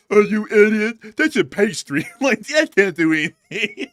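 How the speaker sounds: slow, loud, high caricature with a laugh; mock dumb commenter. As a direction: ham up the idiot who thinks Danish is a pastry. Mocking an ignorant commenter.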